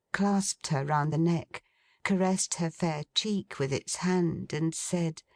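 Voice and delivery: ordinary delivery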